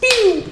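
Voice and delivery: high, loud "Pew!" like something whizzing past; a quick cartoon whoosh; zoom sound effect. Friend zooms in like a streak.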